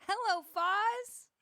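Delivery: high loud drawn-out hello; loud greeting. Real, not acted. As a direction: call out a big hello. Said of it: "I was supposed to say hello".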